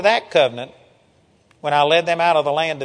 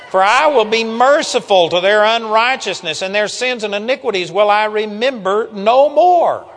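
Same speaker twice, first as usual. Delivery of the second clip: loud, raised preaching cadence; emphatic scripture proclamation; 4 semitones higher, 5 dB louder than usual. Real, not acted. Preacher repeating the good news to congregation, loud and emphatic. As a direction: proclaim the verse with full conviction. Triumphantly rereading the promised verse.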